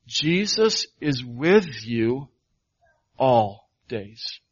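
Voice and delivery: very slow, every word weighted; slow proclamation. Acted. Pastor proclaiming to doubters that Jesus is with them, slow and weighted. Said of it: "Let me stand before you and proclaim".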